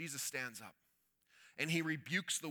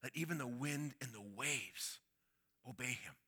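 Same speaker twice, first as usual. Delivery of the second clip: low, soft, wondering question; awed murmur; 3 semitones lower, 6 dB quieter than usual. Acted. Disciples whisper to each other about Jesus in awe. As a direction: ask it under your breath in awe. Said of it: "trying to understand".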